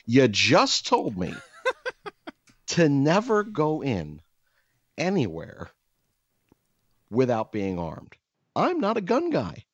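high-pitched, incredulous comic outburst; exasperated protest. Retold or imitated. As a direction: act out disbelief, pitch climbing as you protest. Acts protest at contradictory request.

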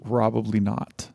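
ordinary delivery

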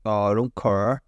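broad Northern Irish, CARE becoming car; low-pitched Northern Irish with an open-vowelled CARE; classic Northern Irish accent. Shown as a demonstration. Showing the disliked accent, classic Northern Irish. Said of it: "the classic Northern Irish accent".

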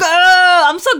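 loud, high triumphant ah; excited shout. Real, not acted. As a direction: cheer for yourself. Triumphant cry after alien song.

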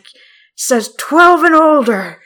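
put-on slow, loud scolding old man; raspy old man voice. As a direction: drag it out like a cranky old man. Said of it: "raspy old man voice".

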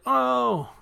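sudden high loud oh; a yelp of surprise at the shock; surprised oh. Real, not acted. Retelling Tim's electrocution, a sudden surprised oh.

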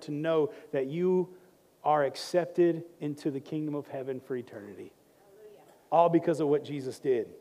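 soft, hushed praying voice; hushed closing prayer; quiet prayer. Acted. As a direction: pray low and tender to God. Prayer and scripture close.

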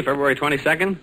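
ordinary delivery

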